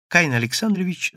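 ordinary delivery

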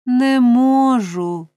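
a hammed-up lady's moan. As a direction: groan it out as if in pain. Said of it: "простогнала господиня".

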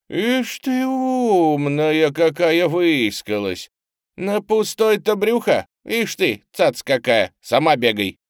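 loud, high, scornful grumble; grumbling. A narrator's reading. Street urchin rejecting the woman's offer, loud grumbling. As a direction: jeer back grouchily, drawing out the opening. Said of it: "ворчливо раздалось из кучи".

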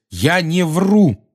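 high, loud, slow-stressed denial; loud protest. A narrator's reading. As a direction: insist on every word. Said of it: "громко заявил Гарри".

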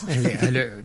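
posh granny answering the phone; telephone voice. Retold or imitated. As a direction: sing out a prim telephone hello. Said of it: "putting on a telephone voice".

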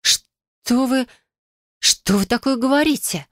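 high, shocked protest; feigned shock. Acted. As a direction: play shocked innocence. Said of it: "изобразив шок".

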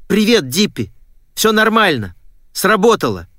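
high, excited announcement; jubilant. A narrator's reading. Girl phoning her friend with good news, jubilant.

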